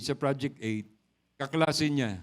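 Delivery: ordinary delivery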